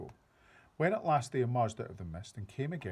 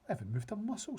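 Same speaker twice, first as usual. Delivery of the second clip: put-on high child's voice, protesting; a young girl insisting; girl protesting to the giant, high; little girl; 10 semitones higher, faster than usual. A narrator's reading. Sophie's voice.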